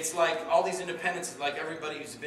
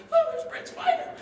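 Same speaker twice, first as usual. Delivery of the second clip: very high, louder squeal of recognition; mock excited fan; 8 dB louder than usual. Lady in front spots Brent Spiner, mock excited. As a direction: gush in a mock star-struck falsetto. Imitates lady spotting a star.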